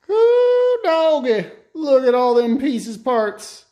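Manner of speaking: high, loud excited whoop; thrilled at the pile of parts. Real, not acted.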